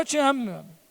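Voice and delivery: softly and lovingly, to a friend met on the street; soft tender declaration. Acted. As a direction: say it quietly with open affection. Acted embrace of a passerby.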